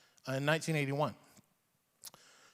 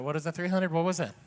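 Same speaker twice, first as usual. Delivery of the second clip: usual speaking voice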